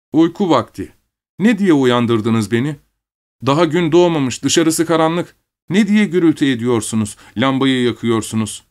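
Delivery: put-on high-pitched boy, crossly complaining; scolding young boy. A narrator's reading. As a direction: voice a little boy scolding his parents. Young son scolds parents. (yüksek perdeden azarlayan küçük oğlan)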